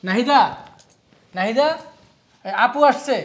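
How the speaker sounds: loud call to the next room. Acted. Husband calls his wife to greet the guest, loud call. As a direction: shout her name across the house.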